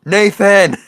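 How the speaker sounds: high, loud mock yell of a name; imitated yell. Game companion calling out to Nathan as he dies, mock yell. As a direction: yell it out like an alarmed game character. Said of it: "they'll yell out".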